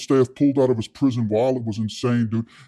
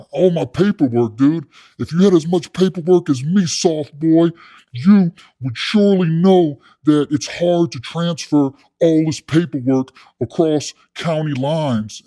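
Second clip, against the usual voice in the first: louder, raised lecturing voice; blustering cellmate; 3 semitones higher, 5 dB louder than usual. Retold or imitated. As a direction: imitate a blowhard inmate schooling a rookie. Acting out cellmate lecturing him.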